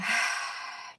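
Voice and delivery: airy, releasing sigh; audible sigh out. Shown as a demonstration. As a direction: let the breath go in a long sigh. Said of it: "maybe sighing it out".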